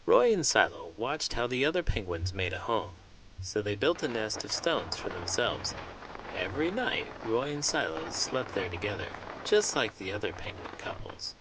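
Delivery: put-on warm, lively read-aloud for young kids; storybook narration. A narrator's reading. Host reading a picture book to children, lively storybook narration. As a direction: tell the penguin story to a room of children.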